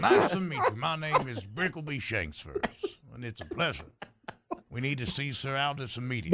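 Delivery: polite Southern gentleman introduction; lightly played Southern accent. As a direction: introduce yourself with courtly Southern manners. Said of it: "Are we doing the accent for everybody?".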